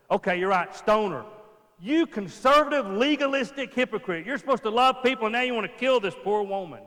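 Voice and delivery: loud, high indignant rant; outraged accusation. Pretend. Elders berating Jesus as a hypocrite, loud and high outrage. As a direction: tear into the hypocrite. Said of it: "Or this way".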